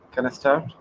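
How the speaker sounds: usual speaking voice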